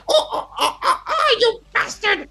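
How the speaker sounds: high-pitched monkey whooping breaking into an insult; angry monkey. Acted. Game master voicing the caught monkey, very angry. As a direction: squawk it like a furious little monkey. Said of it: "he's very angry".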